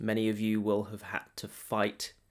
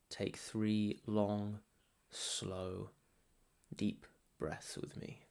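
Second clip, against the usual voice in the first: guided meditation; 4 dB quieter than usual. Real, not acted. Meditation guide settling listeners before the holiday chaos, calm guided meditation. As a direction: guide them gently inward, softer and unhurried.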